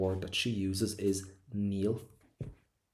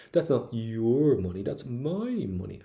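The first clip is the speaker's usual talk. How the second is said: "your" loud and stretched, "my" high and stretched; stress on your and my; 3 semitones higher, 4 dB louder, slower than usual. Shown as a demonstration. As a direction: exaggerate "your" and "my", slow and drawn out. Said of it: "put an emphasis with your voice on your and my".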